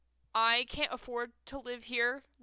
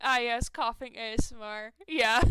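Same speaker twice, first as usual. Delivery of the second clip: ordinary talk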